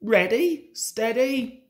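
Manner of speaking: high countdown bursting into a loud "go"; whistled starting call. A narrator's reading. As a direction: call the race start, blasting the last word. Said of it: "whistled Scarlurry".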